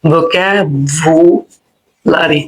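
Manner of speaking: vocabulary drawn out very slowly; English pronunciation. Shown as a demonstration. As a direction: stretch out vocabulary. (ধীরে টেনে vocabulary)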